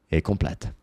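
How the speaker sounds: ordinary delivery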